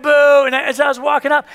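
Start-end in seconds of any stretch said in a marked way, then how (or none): 0.0-0.4 short, loud boo; imitated crowd boo. Banquet crowd booing the winner walking up, loud imitated boo. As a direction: jeer it out like an unhappy audience. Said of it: "they're like".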